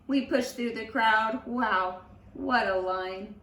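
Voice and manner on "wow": usual speaking voice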